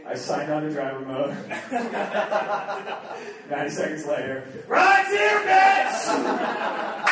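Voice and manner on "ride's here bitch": high, loud triumphant shout; gloating yell. Retold or imitated. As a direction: crow it at her like a win. Uber driver arrives for girlfriend.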